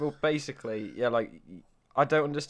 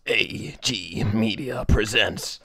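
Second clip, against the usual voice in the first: very slow, drawn-out studio ident voice; grand announcer; 5 dB louder, much slower than usual. Acted. Guest demonstrating the rebranded channel intro, grand announcer. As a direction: intone it like a pompous production-company announcer. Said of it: "just go like this".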